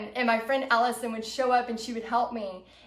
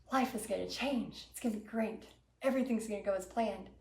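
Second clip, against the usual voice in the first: soft, low-key private hopeful thought; hushed self-assurance; 3 semitones lower, 10 dB quieter than usual. Retold or imitated. Vendor telling herself all will go well, hushed. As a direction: say it quietly to yourself, well under normal volume. Imagines success before nobody buys.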